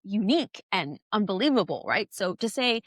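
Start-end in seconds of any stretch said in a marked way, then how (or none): none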